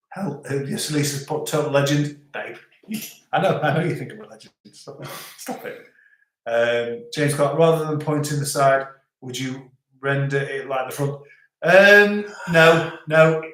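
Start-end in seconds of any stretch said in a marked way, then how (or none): none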